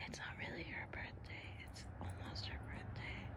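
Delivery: unvoiced whispered aside. Real, not acted. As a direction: whisper it behind the dog's back. Aside to camera, hushed.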